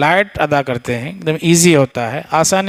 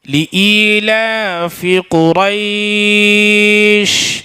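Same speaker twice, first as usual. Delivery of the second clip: slow melodic chant with long held notes; tajweed recitation; 5 semitones higher, much slower than usual. Acted. Teacher showing students the right way in melodic recitation. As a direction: chant the verse, stretching the last word.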